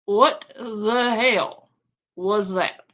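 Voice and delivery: usual speaking voice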